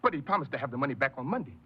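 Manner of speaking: fast, high, desperate insistence; frantic insisting. Acted. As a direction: rush the excuse out, then crack upward. Insisting father will repay.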